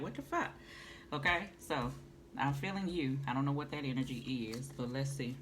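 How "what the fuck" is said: high, stunned exclamation; baffled at the cards; mock disbelief. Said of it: "look at 'em like".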